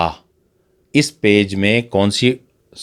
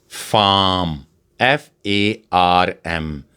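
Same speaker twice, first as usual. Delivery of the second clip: slow spelling out; much slower than usual. Shown as a demonstration. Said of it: "Examples in English".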